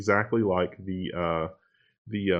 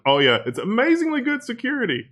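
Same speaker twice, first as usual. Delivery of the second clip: sarcastic mock; 13 semitones higher, 4 dB louder than usual. Mocking the company's claim.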